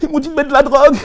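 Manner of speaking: high, loud mock whining excuse; mock pleading protest. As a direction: plead it in a hammed-up squeal. Mimicking someone pleading innocence, very high.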